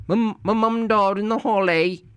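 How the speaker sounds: high put-on British voice, hammed up; a jokey British accent, pitched high; lightly done cockney; mock British accent.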